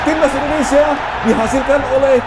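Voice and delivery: excited soccer goal call. Real, not acted. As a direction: scream the goal as it goes in. Live goal call on replay.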